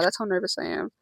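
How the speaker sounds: normal talking voice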